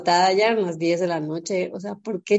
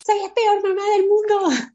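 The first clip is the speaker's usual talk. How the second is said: high, mock despairing cry; mock wail; 12 semitones higher, 3 dB louder than usual. Texting her mom group, high mock-despairing whine. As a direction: whine it like a self-pitying lament. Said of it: "así de".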